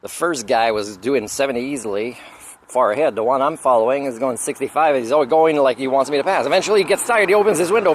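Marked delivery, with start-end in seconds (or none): none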